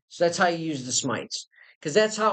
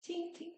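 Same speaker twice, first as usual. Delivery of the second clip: very high, soft little tings; tiny tinkling bullet sound, very soft; imitated bullet pings; 13 semitones higher, 17 dB quieter than usual. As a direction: squeak out faint, high ricochet pings. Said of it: "So not that far off".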